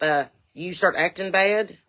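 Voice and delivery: usual speaking voice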